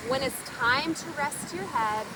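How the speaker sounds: ordinary delivery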